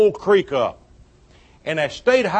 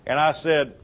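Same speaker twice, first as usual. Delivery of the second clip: ordinary talk, nothing marked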